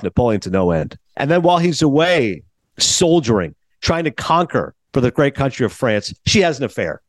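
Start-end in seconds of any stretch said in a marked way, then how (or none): none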